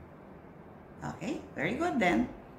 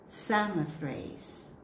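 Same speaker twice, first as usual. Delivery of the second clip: slow careful pronunciation, repeated; slower than usual. Shown as a demonstration. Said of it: "read it slowly".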